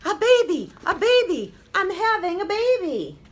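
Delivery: high, loud excited cry; mother bursting out with the news; excited yell. A narrator's reading. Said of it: "woke up and yelled".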